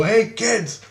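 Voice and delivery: put-on high call to the kids; high, teasing hail. Calling out to a classroom of kids.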